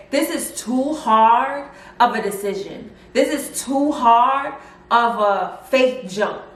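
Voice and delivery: slow, deliberate and heavy; slow earnest. Retold or imitated. Daughter telling mom the choice is too hard, slow and earnest. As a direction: weigh every word slowly.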